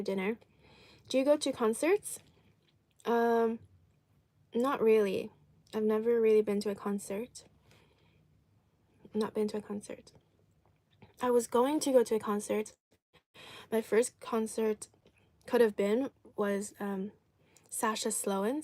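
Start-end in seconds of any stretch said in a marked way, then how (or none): none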